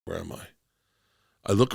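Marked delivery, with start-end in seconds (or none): 0.1-0.5 panicked mutter. Retold or imitated. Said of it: "And I'm like".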